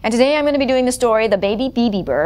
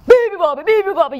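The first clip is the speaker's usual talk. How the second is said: loud, high yelled chant; yelling; 9 semitones higher, 5 dB louder, much faster than usual. Acted. Hippos keeping the bird awake, yelling. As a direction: yell it out as hippos. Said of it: "yelled the hippos".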